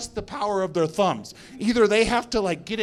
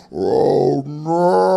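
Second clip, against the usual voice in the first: dread; 7 semitones lower, 6 dB louder than usual. Retold or imitated.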